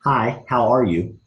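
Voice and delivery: slow, deliberate hello; slow demo greeting. Shown as a demonstration. Coach demonstrating a greeting to the team, slow. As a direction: spell the greeting out slowly for the class. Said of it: "you're gonna say".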